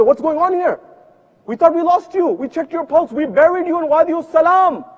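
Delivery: gasping at a miracle; family shocked to see the buried child; stunned disbelief. Acted. Family sees the dead child alive.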